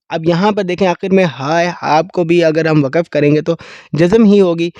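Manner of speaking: usual speaking voice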